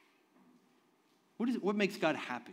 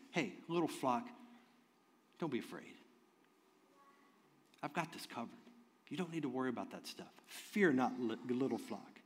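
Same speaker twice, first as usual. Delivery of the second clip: tender; 4 semitones lower, 9 dB quieter than usual. Retold or imitated. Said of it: "Jesus is speaking tenderly to them".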